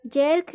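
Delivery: जर्क with an open "e" vowel, like "jerk" read as spelt; wrong pronunciation. Shown as a demonstration. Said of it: "जर्क नहीं पढ़ा जाएगा". (जर्क का ग़लत उच्चारण, "जेर्क" जैसा)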